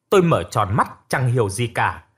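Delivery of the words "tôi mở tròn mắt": usual speaking voice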